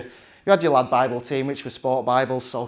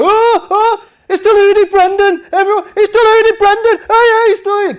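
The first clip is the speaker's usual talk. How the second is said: much higher, louder excited yelling; Stan Collymore excited; 13 dB louder than usual. Retold or imitated. Footballer spotting deluded Brendan at the awards, shouting high and excited. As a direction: shriek it out like an overjoyed fan spotting a celebrity. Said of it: "Getting really excited".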